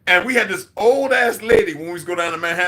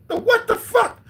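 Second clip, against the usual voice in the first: very high, laughing disbelief; laughing disbelief at a strange word; incredulous laugh; slower than usual. Retold or imitated. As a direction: squeal it in amused shock. Disbelief at "Dracos.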